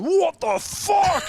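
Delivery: high, slow, drawn-out outrage; shocked parents. Retold or imitated. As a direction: parents arriving at chaos, shocked. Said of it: "my parents drive up the driveway going".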